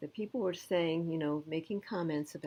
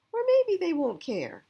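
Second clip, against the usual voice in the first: much higher and louder, sudden doubt; a touch of worry; 11 semitones higher, 7 dB louder than usual. Acted. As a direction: let the fear jump out. Dog's worried thought.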